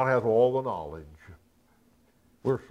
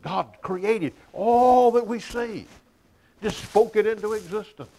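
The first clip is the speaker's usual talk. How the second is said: fervent preaching cry; 11 semitones higher, 6 dB louder than usual. Real, not acted. Sermon climax on God's power.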